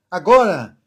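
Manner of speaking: ordinary talk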